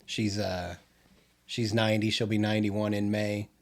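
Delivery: ordinary delivery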